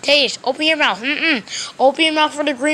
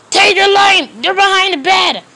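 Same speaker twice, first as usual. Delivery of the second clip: louder, higher pretend yell at Teddy; 6 semitones higher, 6 dB louder than usual. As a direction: catch Teddy in a lie and yell it out. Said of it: "I'm sorry I yell at you".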